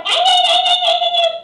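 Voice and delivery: very high, loud yapping wail; piercing imitation of a nagging tourist; mock shrieking. Comic mimicking the noisy wife on the metro, shrieked.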